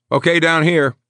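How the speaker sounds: high, loud, firm command; medic giving orders. A narrator's reading. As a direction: direct the lift firmly. Medic directing stretcher move.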